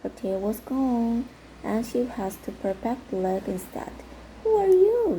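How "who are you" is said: much higher and louder, startled question; surprised. Acted. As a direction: blurt it out in surprise. Prince surprised to find her.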